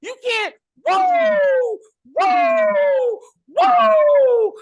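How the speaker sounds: bad Ric Flair woo. Mocking the actor's failed Ric Flair woo, shouted high. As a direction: ham up a botched wrestling woo into a shout. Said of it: "And then his woo was".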